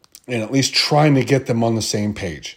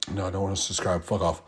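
softer, annoyed under the breath; muttered aside; 4 semitones lower, 10 dB quieter, faster than usual. Real, not acted. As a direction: grumble it quietly at the screen.

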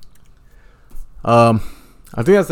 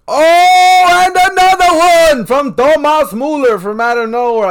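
shouting; 8 dB louder, slower than usual. Real, not acted. Watching soccer, goal reaction.

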